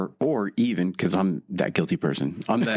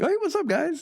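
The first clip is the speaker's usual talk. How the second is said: much higher, bright mock hello; cheery greeting. Man mimics himself greeting friends, leaving his cart, much higher and cheery. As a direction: chirp it out like spotting old friends.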